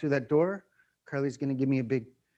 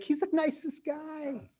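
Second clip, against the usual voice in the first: much higher, slow, sweet praise; coworker voice; 12 semitones higher, 5 dB quieter, slower than usual. Retold or imitated. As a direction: gush like a surprised coworker. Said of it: "everyone's like".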